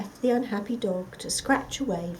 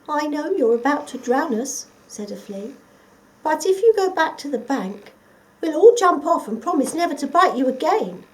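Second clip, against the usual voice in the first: higher, loud flea voice; tiny flea; 7 semitones higher, 10 dB louder than usual. Acted. The flea bargaining with the dog, higher. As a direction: plead with the dog.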